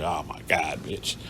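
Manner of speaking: low, hushed disbelief; under-breath aside. Retold or imitated. Narrator muttering to himself while she lies to police. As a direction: mutter it so nobody hears. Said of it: "I'm like".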